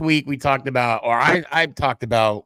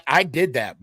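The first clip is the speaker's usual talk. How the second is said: ordinary delivery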